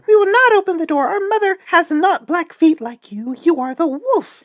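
put-on high, squeaky little-kid voices; kids crying out. A narrator's reading. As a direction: shout back as small high-voiced kids. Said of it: "saw them and cried".

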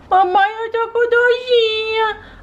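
put-on slow, high, whimpering child voice; a sick little daughter complaining to mommy; whiny sick little girl. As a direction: whine to mom about the pain.

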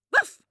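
one high loud yap; single bark. A narrator's reading. Dog answering the boy with a bark. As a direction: give one sharp bark. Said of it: "zegt Takkie".

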